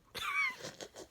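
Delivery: a high burst of laughter. Real, not acted. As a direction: laugh high at your own joke. Laughing at own story.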